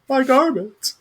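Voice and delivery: high-pitched mock cry from afar; distant yell. Someone far away missing their underwear, high mock yell. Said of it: "you hear someone yelling".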